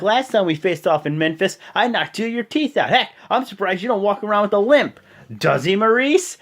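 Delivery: loud, high trash talk; taunting. Retold or imitated. Wrestler imitated bragging about knocked-out teeth, taunting. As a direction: boast at a rival in an acted voice.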